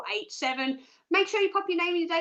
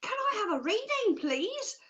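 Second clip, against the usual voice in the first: higher-pitched, cheery pretend caller asking politely; sing-song polite phone request; eager caller; 6 semitones higher than usual. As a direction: act out a caller ringing in. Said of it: "pick up that phone and go".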